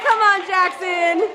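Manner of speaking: high, slow call across the yard. Real, not acted. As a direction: call him over. Calling out to a kid.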